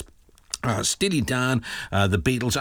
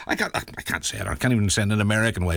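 usual speaking voice

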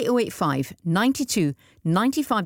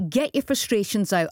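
ordinary talk, nothing marked

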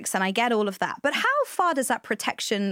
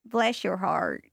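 slow, lower Southern drawl; Southern accent; 4 semitones lower, much slower than usual. Pretend. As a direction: draw it out in a put-on Southern accent. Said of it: "That is our Southern phrase".

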